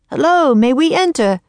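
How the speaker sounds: high, loud call at the doors. A narrator's reading. Teddy calling out at the castle doors, loud and high. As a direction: call out politely but loud. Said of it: "he called".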